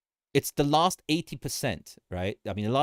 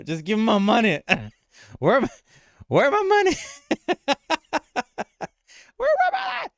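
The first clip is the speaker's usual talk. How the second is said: much higher, louder giggly squeal; laughing so hard the voice squeaks up; 12 semitones higher, 6 dB louder, faster than usual. Real, not acted. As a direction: crack up into a silly high squeak. Laughing silly money rant.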